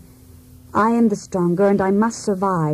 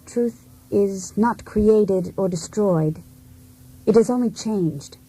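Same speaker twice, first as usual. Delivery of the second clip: measured; slower than usual. Acted. Measured pronouncement.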